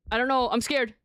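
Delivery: ordinary talk, nothing marked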